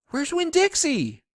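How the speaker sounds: high, loud panicked shout. A narrator's reading. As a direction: shout the question in alarm. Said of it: "I shouted".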